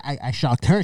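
ordinary talk

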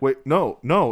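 high, loud mock alarm; upset. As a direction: imitate a man in sudden panic. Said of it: "he gets all upset".

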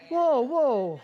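high, loud "whoa, whoa"; protesting to crowd. Real, not acted. Preacher to a disapproving crowd, high loud protest. Hushing the audience's reaction to the story.

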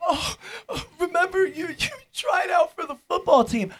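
high-pitched imitation of an over-eager acquaintance; gushing acquaintance. Old acquaintance gushing to a reluctant host, mock over-eager. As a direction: put on a high, excited reminiscing voice. Mocking retelling of his acquaintance.

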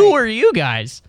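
high, slow mock bewilderment; mock disbelief. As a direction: play up the baffled outsider. Mock horror at hometown folks.

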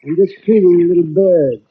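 usual speaking voice

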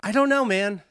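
ordinary talk, nothing marked

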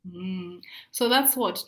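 ordinary talk, nothing marked

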